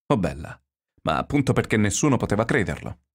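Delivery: ordinary delivery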